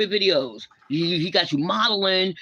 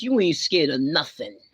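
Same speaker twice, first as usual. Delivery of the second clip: ordinary talk, nothing marked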